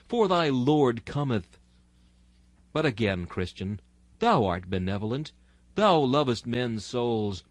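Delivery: ordinary talk, nothing marked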